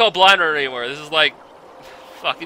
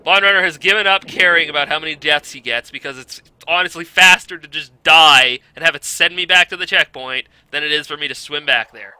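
normal talking voice

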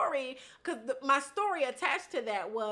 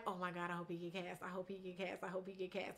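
much softer, fast, near-whispered wish; hushed inner hope; 5 semitones lower, 15 dB quieter, faster than usual. Retold or imitated. Hoping a fellow actor gets cast, hushed eager wish. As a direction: pray under your breath.

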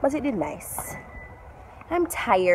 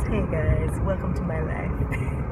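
low croaky morning voice, slowly; hoarse just-woke-up voice; rusty unused voice; 4 semitones lower, 4 dB quieter, slower than usual. Real, not acted. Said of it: "this is my morning voice".

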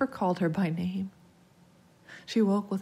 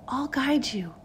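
put-on high, coaxing child; ghostly playmate luring him; eerie child's voice; 9 semitones higher than usual. A narrator's reading. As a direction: reassure up high like a little girl. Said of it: "the voice responded".

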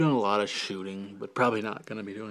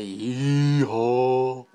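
drawn-out, louder cowboy-style holler; exaggerated yee-haw; 5 dB louder than usual. Shown as a demonstration. Vlogger showing how it wouldn't be said, exaggerated yee-haw. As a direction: stretch both syllables way out. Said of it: "You wouldn't say".

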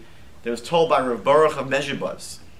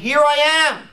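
high, louder call; 11 semitones higher, 6 dB louder, faster than usual. Acted. Miracle calling out to people, high loud call. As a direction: announce yourself like a raised banner.

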